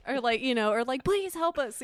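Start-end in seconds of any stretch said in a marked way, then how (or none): 1.0-1.8 high pleading shriek; mock shriek. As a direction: cry out for help, high. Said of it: "distant voiceovers of people shrieking".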